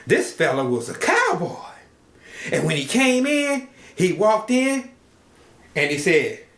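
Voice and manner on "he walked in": usual speaking voice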